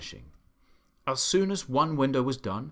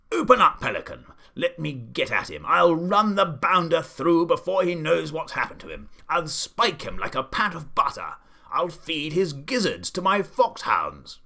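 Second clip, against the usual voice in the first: loud blustering threats; furious shouting; 5 semitones higher, 7 dB louder than usual. A narrator's reading. The Duke raging at the pelican to open up, loud bluster. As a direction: roar at the pelican.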